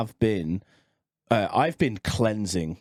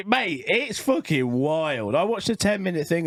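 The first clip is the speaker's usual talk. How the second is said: ordinary talk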